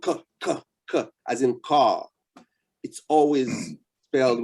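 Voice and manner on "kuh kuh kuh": "K" as a bare "kuh", repeated; three separate "kuh" sounds for "K". Shown as a demonstration. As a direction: say "K" as the sound starting "car", not the letter name. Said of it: "every time you hear the sound K".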